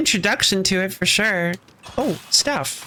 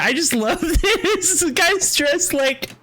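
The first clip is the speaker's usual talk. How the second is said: high, slow words through laughter; delighted, laughter-broken remark; amused laughing; 6 semitones higher, slower than usual. Real, not acted. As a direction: laugh while saying it. Streamer amused at costume.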